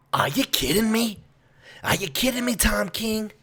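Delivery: high-pitched exasperated disbelief; strained high, can't believe it; incredulous. Real, not acted. Incredulous at the writer's panel count.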